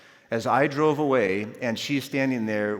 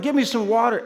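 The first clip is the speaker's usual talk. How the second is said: raised, higher voice, urgent demand; retold yell; 10 semitones higher, 6 dB louder than usual. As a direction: call out for help mid-story, short of a full scream. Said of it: "I'm yelling".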